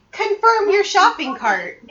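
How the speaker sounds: high, loud, slow bird impression; bird mimicking voice. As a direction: squawk out the order like a mimicking bird. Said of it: "able to mimic the voice enough".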